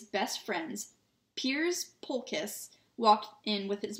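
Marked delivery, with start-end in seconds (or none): none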